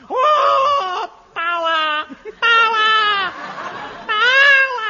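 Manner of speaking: high, loud drawn-out howl; hammed-up high yowl; comic wail. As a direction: wail it in mock anguish.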